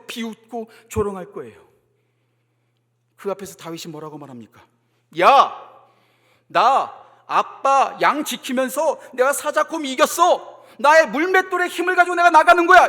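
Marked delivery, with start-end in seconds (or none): 5.2-12.9 loud, high swaggering declaration; boastful challenge. Acted. Imagined David to Goliath, loud boastful challenge. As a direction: boast at full voice, mock heroic.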